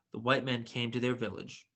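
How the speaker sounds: ordinary delivery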